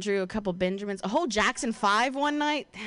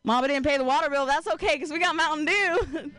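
put-on country accent; 3 semitones higher than usual. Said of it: "like, in a country accent".

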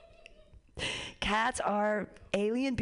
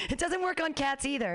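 ordinary talk

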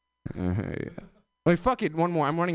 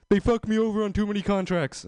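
Trump griping in a raised pitch; put-on higher voice, complaining as Trump; aggrieved Trump; 8 semitones higher than usual. Comic voicing Trump's supposed reasoning.

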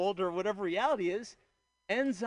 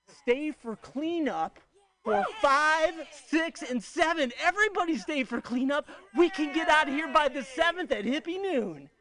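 high, loud call to the crowd; cheering the crowd on to stay for cleanup; rallying call; 7 semitones higher, 4 dB louder than usual. Real, not acted. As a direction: rouse everyone to stay and help.

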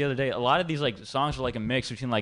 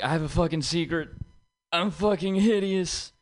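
put-on kid confessing; 6 semitones higher than usual. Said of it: "he was just like".

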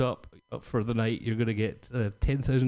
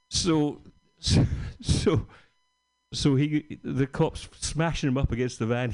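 ordinary talk

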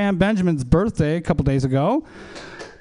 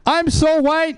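hyped call-out; 10 semitones higher, much slower than usual. Acted. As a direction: call it to the crowd. Repeated crowd call.

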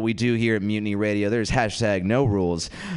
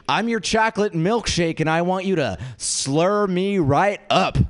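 mock brazen retort; 6 semitones higher than usual. Comic answers the street yeller, mock brazen. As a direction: stretch the last words out, high and showy.

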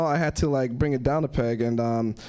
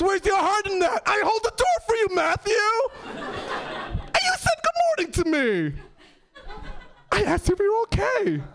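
high-pitched indignant scolding, climbing; hurt outrage; 11 semitones higher than usual. Retold or imitated. Betrayed teacher scolding pupil over killing curse, mounting high outrage. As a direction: build from reproach to a high wounded wail.

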